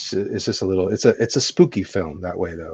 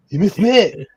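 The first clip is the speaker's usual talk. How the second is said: high mock Belgian accent, lisped; lightly imitating a martial arts star; Van Damme impression; 11 semitones higher, 6 dB louder, slower than usual. Friend quoting a Van Damme scene, high mock accent. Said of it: "That's, that's Van Damme".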